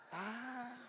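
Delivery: ordinary talk, nothing marked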